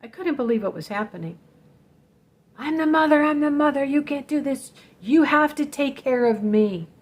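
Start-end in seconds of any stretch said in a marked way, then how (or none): 2.6-6.9 raised, higher-pitched demanding voice; acted-out overwrought demand; mother's rant. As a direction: insist like an angry parent, pitched up. Mother's rant re-enacted during attack.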